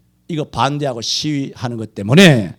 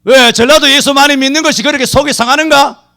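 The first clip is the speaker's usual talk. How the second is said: ordinary talk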